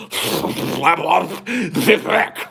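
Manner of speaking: mock slurred, drawn-out self-introduction; Slavoj Zizek. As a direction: mangle the name slowly, high and garbled. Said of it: "I can't understand a word that comes out".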